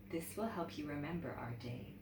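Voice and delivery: soft, low, tender grandma; gentle grandmother. Acted. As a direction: hand it over softly and kindly. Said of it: "she said".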